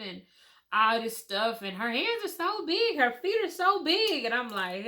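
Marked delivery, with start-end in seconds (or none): 1.6-4.2 much higher, marveling voice; amazed nurse. Retold or imitated. Nurse gushing over the newborn's big hands and feet, high amazed.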